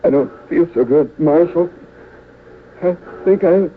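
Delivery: weak, halting words with long pauses; a shot man losing strength mid-sentence; wounded and fading. Acted. Shot baggage clerk answering the marshal, weak and halting.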